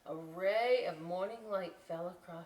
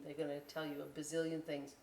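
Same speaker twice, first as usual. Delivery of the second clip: ordinary delivery, nothing marked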